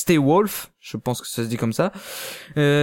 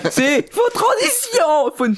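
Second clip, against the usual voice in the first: laughing; 11 semitones higher, slower than usual. Real, not acted. Laughing reminder to transition.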